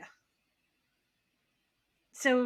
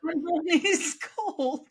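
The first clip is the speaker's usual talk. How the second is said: louder, higher, drawn out through a laugh; 7 semitones higher, 6 dB louder, slower than usual. Real, not acted. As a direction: break into laughter as you finish. Laughing while finishing her comparison.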